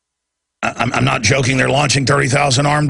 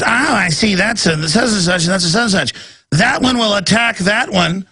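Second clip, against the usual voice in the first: higher, lecturing busybody voice; mock know-it-all woman; 6 semitones higher than usual. Mocking the woman lecturing about his fish, higher voice. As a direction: play the prying customer explaining fish. Host mimics nosy woman at store.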